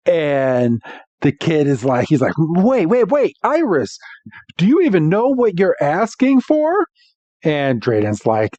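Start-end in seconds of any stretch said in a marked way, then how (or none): 2.4-6.9 high-pitched mock alarm, rising through the question; play-acted incredulous protest; alarmed disbelief. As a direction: cut in as a flabbergasted kid. Kid alarmed at Iris's challenge.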